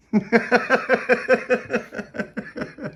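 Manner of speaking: high staccato giggle; character's laugh. A narrator's reading. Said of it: "And Pauline laughed".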